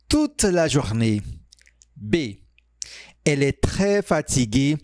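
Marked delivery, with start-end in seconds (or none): none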